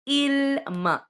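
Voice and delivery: "il ma" lifted high and stressed as the example; "il ma" sung out high, teacher showing the word; high demo of "il ma". Shown as a demonstration. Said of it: "per esempio".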